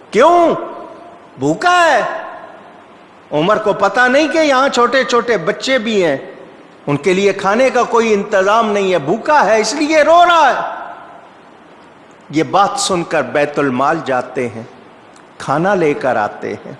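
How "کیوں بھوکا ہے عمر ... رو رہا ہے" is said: high-pitched, bitter complaint of a mother; aggrieved outcry. Retold or imitated. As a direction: cry out the reproach, pitch leaping up. Acted woman's bitter complaint from inside. (شکوے بھری اونچی پکار)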